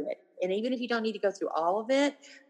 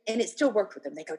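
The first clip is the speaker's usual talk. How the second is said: normal talking voice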